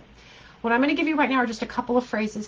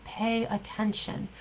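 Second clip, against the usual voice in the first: slow, softer, deliberate "Pay attention"; slow emphasis; 5 dB quieter, slower than usual. Shown as a demonstration. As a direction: lean on each word of "Pay attention".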